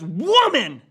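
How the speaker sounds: blunt, dismissive "Woman"; a curt, loud "Woman". Retold or imitated. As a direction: bark it out like a brush-off. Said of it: "That's how at least I read it".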